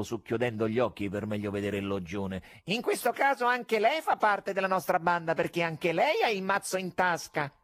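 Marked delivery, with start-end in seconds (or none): 2.6-7.5 much higher, cheeky showman call; mocking stage magician; conjurer's call. A narrator's reading. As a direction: toss it out to the stalls.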